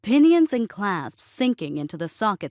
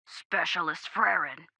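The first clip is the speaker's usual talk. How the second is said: softer, gruff voice over comms; understated gruff radio call; gruff sergeant; 5 dB quieter than usual. A narrator's reading. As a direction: gruffly call the soldier by rank. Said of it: "The gruff voice came again".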